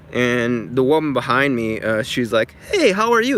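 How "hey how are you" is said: mock sweet greeting, pitched much higher; imitating the clerk being overly sweet to another customer; all sweet. Clerk greeting the woman behind, in a syrupy sweet voice. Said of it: "all sweet to her".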